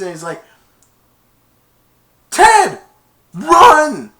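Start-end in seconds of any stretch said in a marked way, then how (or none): 2.3-4.1 very high, loud scream. Retold or imitated. Teammates screaming at Ted to run, very high loud scream. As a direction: shriek at him to run. Yelling at runner.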